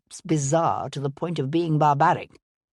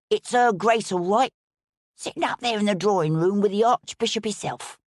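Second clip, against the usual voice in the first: a lightly voiced rough young groom, a bit higher; eager servant blurting out the news; hint of a common lad, pitched up; Tom's voice; 4 semitones higher than usual. A narrator's reading. Tom the groom reports the news.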